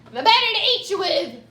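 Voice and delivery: loud triumphant wolf; wolf cries out. Acted. Wolf reveals itself to Red, loud. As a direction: roar the line out. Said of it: "cried the wolf".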